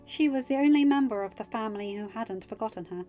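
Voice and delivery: usual speaking voice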